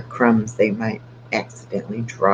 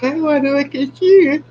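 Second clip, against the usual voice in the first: dog voice; 7 dB louder than usual. Pretend. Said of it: "She's like".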